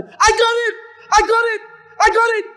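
high, loud triumphant cry; joyful shout. Retold or imitated. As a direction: shout it out like a breakthrough. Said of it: "he starts shouting".

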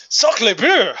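mock scream. A narrator's reading. Game master voicing a police officer shot at, mock scream. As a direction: cry out like a startled Frenchman.

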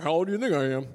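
mild imitation of an old soul teasing. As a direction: redo the line as the molasses-mouthed guy.

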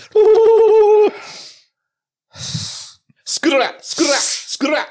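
high laughing try at rolled r on "skur"; rolled r attempt. Shown as a demonstration. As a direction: blow hard to trill "skur". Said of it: "it just goes".

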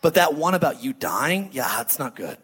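low, mock-tactful correction; awkward private aside. Peter takes Jesus aside to correct him, low awkward aside. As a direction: take someone aside and gently object, hammed up. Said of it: "He brought him over to his side".

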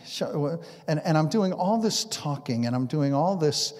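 ordinary talk

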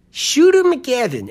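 high, slow imitation in a rough Boston accent; not-quite-Boston accent. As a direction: puzzle over the name in a near-Boston accent. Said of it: "It's not quite Boston".

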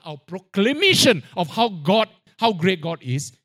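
ordinary delivery

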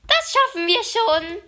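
building, loud eager start; excited countdown. Acted. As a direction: rev up into a shout. Crow starts running to fly.

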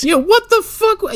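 high, slow, loud disbelief; baffled. Real, not acted. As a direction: draw it out in outraged confusion. Baffled by the montage.

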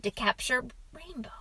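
hushed storyteller's question; suspenseful whisper. A narrator's reading. Father quizzing boy about the wolf, suspenseful whisper.

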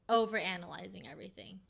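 ordinary delivery

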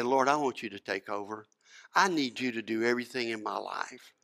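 soft, hushed plea to the Lord; humble prayer. Retold or imitated. Preacher voicing a believer's prayer, softly hushed. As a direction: pray it low and quiet, surrendering. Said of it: "we're honest with ourselves and we say".

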